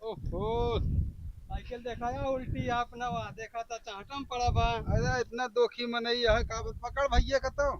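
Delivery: softly gossiping; hushed. Acted. Hushed talk about drunk. (धीमी आवाज़ में)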